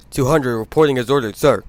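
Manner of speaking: loud, crisp soldierly report; loud eager engine reporting for duty. Acted. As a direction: snap to attention and sound off.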